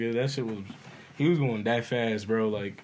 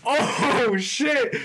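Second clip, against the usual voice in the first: high, louder yelp at the headlights; startled yell; 11 semitones higher, 4 dB louder than usual. Retold or imitated. Driver startled by speeding car, high yelp. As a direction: blurt it out like headlights just came at you. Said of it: "And I'm just like".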